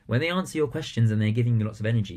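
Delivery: ordinary talk, nothing marked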